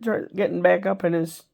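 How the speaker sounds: ordinary delivery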